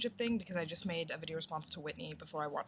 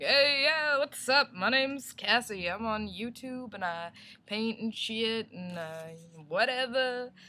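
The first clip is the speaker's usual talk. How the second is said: much higher, louder, slow goofy greeting; goofy joker; 4 semitones higher, 7 dB louder, slower than usual. Pretend. Famous artist introducing herself as a goofy, laid-back joker, much louder and higher. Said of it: "this huge joker".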